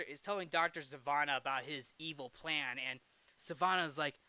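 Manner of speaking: normal talking voice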